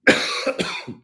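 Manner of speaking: hard cough to clear throat. Real, not acted. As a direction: cough out the frog. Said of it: "I got a frog in my throat".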